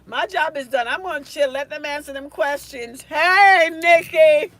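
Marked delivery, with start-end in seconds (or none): 3.0-4.5 much louder hey across the room; calling out. Real, not acted. Teacher calling out to a student on the live, loud. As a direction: call her name out loud.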